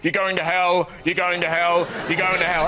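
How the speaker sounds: loud, repetitive doom chant; mock street preacher. Preacher with loudhailer condemning passers-by, chanting. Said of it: "with a loud hailer".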